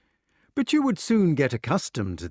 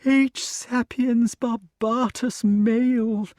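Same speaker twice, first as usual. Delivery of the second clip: high, slow, deliberate naming; satisfied classifying snake; 11 semitones higher, 4 dB louder, slower than usual. A narrator's reading. Snake classifies the human for Wart, high and pleased. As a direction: savour each word of the label. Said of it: "feeling safer now that he had got the subject classified".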